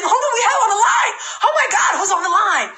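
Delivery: high, hyped-up mock shout; fired-up excitement. Sponsor caught up in recruit's hype, acted excited shouting. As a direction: play someone whipped into a frenzy of anticipation. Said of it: "He has me saying".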